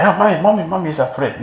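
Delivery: loud, high-pitched mock cry for mommy; crying boy. A boy scared of a cat calls his mother, mock crying. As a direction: imitate a frightened child wailing to his mother. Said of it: "he will cry".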